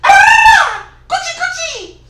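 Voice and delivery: shrieked, very high [name] cry; hammed-up [name] squeal, far above own voice; [name] impression. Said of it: "will be played by [name]".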